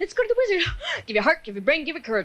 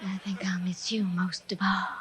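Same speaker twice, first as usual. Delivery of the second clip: soft hushed goodbye; whispered farewell; 10 semitones lower, 8 dB quieter than usual. Acted. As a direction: breathe it as a secret farewell.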